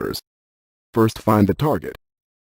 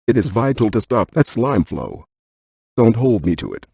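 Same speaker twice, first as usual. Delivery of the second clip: normal talking voice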